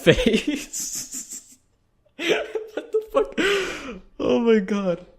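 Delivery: high-pitched, laughing disbelief; helpless laughter. Real, not acted. Reacting to the face he's making, cracking up. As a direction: crack up and squeeze the words out.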